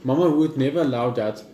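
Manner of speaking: ordinary talk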